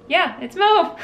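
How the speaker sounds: high, loud, gleeful exclamation; delighted confirmation. Real, not acted. Checking the gifted yarn's label, delighted confirmation. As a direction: sing out the confirmation, high and pleased.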